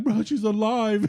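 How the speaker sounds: high-pitched mock pleading; retold crying plea. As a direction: imitate a distraught friend insisting, without real tears. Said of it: "he's, like, literally crying".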